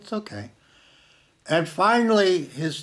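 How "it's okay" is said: soothing. Retold or imitated. Soothing the snapping dog.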